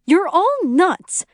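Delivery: high, loud, drawn-out dismissal; exasperated. A narrator's reading. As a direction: write them all off before stomping away. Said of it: "stomping into the house".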